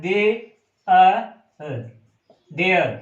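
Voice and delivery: they, a, then there, with they blended into a; pronunciation split up. Shown as a demonstration. Teacher showing students how there is pronounced, split slowly. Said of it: "मात्रा का अंतर है".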